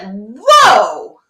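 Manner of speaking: a loud, drawn-out whoa of sudden realization; amazed whoa. Retold or imitated. Client realizing the world shifted, amazed. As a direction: let the lightbulb go on. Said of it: "she was like".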